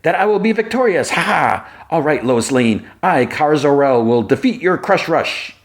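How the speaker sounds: high, triumphant warrior boast; Kara Zor-El gloating at Lois, high and grand; boastful Kara. Retold or imitated. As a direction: crow your victory like a proud alien warrior. Kara boasts, retold cartoon scene.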